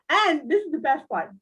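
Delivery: ordinary delivery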